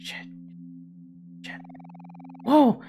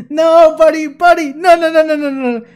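much louder, higher "no, no, no"; loud protest; 9 semitones higher, 12 dB louder than usual. Real, not acted. Protesting the apology dropped on Kel, loud. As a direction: shout him down, buddy.

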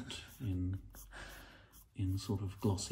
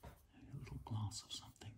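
hushed, barely voiced whisper; soft real whisper, no voice; 5 semitones higher, 6 dB quieter, faster than usual. Real, not acted. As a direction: whisper it as if a ghost is near. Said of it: "I don't know why I'm whispering".

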